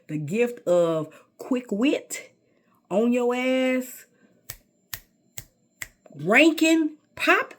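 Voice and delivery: building from a slow loud boast to a high, shouted pop; slow and loud, then climb high and shout the last words. Acted. Bragging to the audience about roasting people, rising from slow loud boast to high shout.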